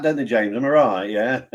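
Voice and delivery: ordinary talk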